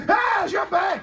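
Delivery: high yelped [name] shout; [name] scream. Acted. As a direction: yelp it like a startled funk singer. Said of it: "my impression of [name]".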